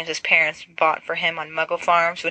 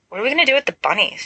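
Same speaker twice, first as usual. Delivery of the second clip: somewhat deliriously; 6 semitones higher, 3 dB louder, much faster than usual. Acted. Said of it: "somewhat deliriously".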